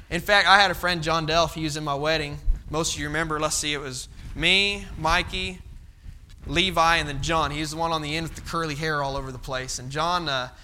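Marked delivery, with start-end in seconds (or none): none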